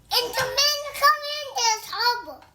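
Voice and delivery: loud, high indignant burst; indignant protest. Real, not acted. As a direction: protest it hotly at high pitch. Child protests at being disowned. (باحتجاج وبصوت عالي)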